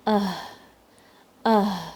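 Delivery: آہ with vocal cords fully relaxed; loose, open aah. Shown as a demonstration. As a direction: sigh out آہ, air and sound flowing. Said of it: "vocal کارڈز completely relax ہوتی ہیں".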